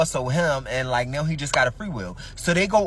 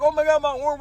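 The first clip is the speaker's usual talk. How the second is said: panicked; 11 semitones higher, 4 dB louder than usual. Retold or imitated. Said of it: "She like".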